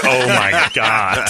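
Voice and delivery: drawn-out mock horror, very slow; a slow, drawn-out gasp of mock shock; slow, stretched-out shock. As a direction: stretch every word in wide-eyed horror. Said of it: "Her eyes were like".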